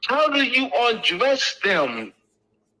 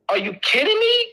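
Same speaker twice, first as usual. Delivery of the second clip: much higher, disbelieving "are you kidding me"; incredulous; 13 semitones higher than usual. Real, not acted. Teacher to students, scoffing at the case in high disbelief. Mocking a weak court case.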